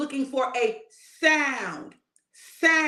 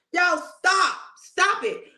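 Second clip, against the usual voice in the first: shout; 8 semitones higher, slower than usual. Real, not acted. Preacher calling out congregation.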